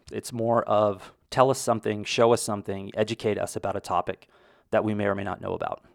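ordinary delivery

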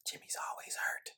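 conspiratorial whispered aside. Acted. Host lets listeners in on a secret, whispered.

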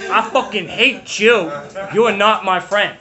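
shouted high and loud, slowed down; hammed-up yell at the group; mock angry outburst. Retold outburst at group members.

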